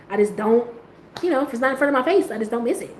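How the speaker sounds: normal talking voice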